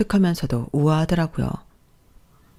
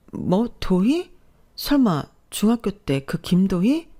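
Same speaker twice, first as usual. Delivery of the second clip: high, slow, rising disbelief; drawn-out, incredulous double take; astonished recognition; 4 semitones higher, slower than usual. A narrator's reading. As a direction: question it slowly, voice climbing in amazement. Recognises long-lost school friend.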